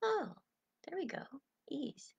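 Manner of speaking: high, soft, letting-go murmur; easing sigh of relief. Acted. Coach voicing a relieved inner thought, soft and high. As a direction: sigh into ease, fading to a whisper. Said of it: "It could be".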